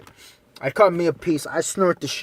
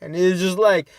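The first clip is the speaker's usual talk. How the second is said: ordinary talk, nothing marked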